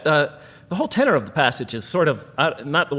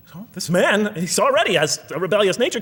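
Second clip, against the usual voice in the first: ordinary talk, nothing marked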